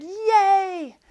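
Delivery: a high, loud cheer; cheering crowd. A narrator's reading. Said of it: "the crowd cheered".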